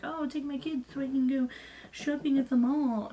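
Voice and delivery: mocking carefree mom. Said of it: "and it's not like I'm like".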